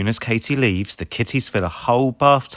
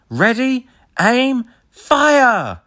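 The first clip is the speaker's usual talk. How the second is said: much higher, louder shout; battle cry; 6 dB louder, much slower than usual. A narrator's reading. Rubble firing the hay bale, loud shout. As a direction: yell the command. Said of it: "says Rubble".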